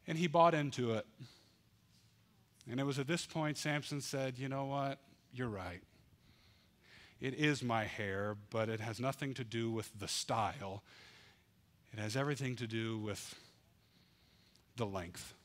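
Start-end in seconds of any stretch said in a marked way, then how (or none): 4.4-15.3 defeated giving in. Acted.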